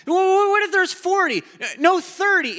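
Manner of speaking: high, loud, rushed haggling; eager haggling. Retold or imitated. Abraham haggles with God over the city, high eager bargaining.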